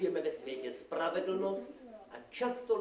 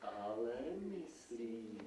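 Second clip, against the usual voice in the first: low, very soft and slow; ominous verdict; 6 semitones lower, 11 dB quieter, slower than usual. Acted. Devil tells magistrate she means it, softly ominous. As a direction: quietly stretch out the threat.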